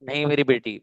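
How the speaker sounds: ordinary talk, nothing marked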